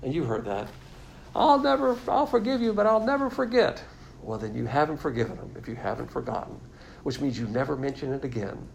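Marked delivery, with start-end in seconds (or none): 1.3-3.8 much higher, whiny mock spouse; mock grudge-holder. Preacher mimicking a resentful spouse in a marriage fight. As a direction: ham up the partner who won't let it go.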